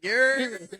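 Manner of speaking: high yelped yer; a sudden high yelp; mock yer call. Friend mimicking the Timbs guy, high yelp.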